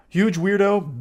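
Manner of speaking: ordinary talk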